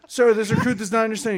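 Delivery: loud, high recruit shout; barked sir yes sir. Retold or imitated. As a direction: bark it at attention.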